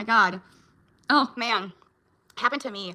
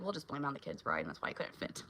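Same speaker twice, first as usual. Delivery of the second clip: whisper; 5 semitones lower, 13 dB quieter than usual. Retold or imitated. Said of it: "I'm like".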